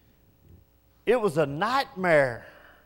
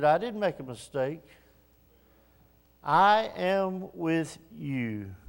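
soft voice of God; 4 semitones lower, 6 dB quieter than usual. Acted. God answers his doubt.

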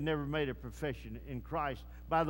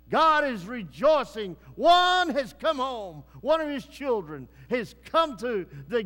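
jubilant shout; 3 semitones higher, 8 dB louder than usual. Acted. Preacher celebrating a saint's homecoming, loud and jubilant. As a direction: shout the victory to the congregation.

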